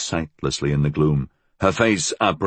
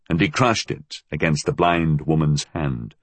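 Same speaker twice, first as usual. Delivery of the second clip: plain reading voice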